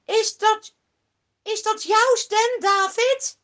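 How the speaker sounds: loud high questioning shout; calling back. Acted. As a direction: call back across to David. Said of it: "Dan roept Saul terug".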